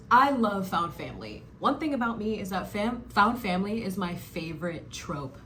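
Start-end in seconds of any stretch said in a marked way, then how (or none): none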